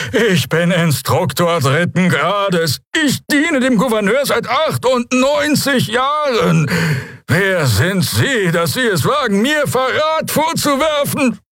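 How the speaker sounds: high, slow alien outrage; indignant Draxyl. Acted. Instructor rebuking those accusing him of treason, indignant. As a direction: declare your rank in outraged high tones.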